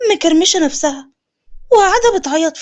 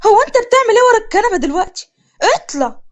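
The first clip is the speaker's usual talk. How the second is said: shocked scolding; 6 semitones higher than usual. Acted. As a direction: catch him hiding and demand why. Shocked at brother hiding. (استنكار بصوت عالي وسريع)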